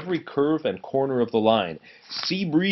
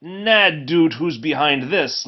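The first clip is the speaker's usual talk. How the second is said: put-on laughing Scottish accent; 5 semitones higher, 4 dB louder, slower than usual. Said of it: "laughed Donald".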